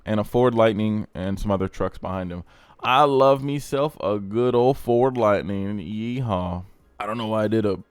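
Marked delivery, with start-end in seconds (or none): 2.8-6.6 slow put-on country drawl; country accent. Car host praising a Ford Lightning, country accent. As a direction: play a country boy praising his truck, drawled. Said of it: "country accent for a Ford Lightning".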